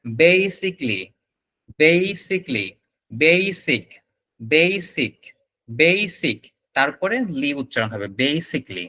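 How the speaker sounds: "basically" and "basic" with a long "bei"; teacher drilling "basically" syllable by syllable; "basically" as "bei-sik-li", drilled; repeat-after-me drill. Shown as a demonstration. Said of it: "উচ্চারণটা আমার সাথে repeat করেন".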